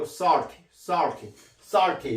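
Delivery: SALTY with a vowel between O and A, like sar-ti; correct in-between vowel. Shown as a demonstration. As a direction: model "salty" with an open rounded ah. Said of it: "이렇게 발음합니다".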